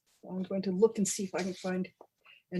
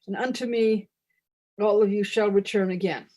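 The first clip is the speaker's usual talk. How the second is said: grand goddess proclamation, louder than chat; mock-solemn ritual reading; 3 semitones higher, 7 dB louder than usual. Chocolate goddess addressing her hungry followers, mock-solemn proclamation. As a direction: declaim it like a tongue-in-cheek scripture.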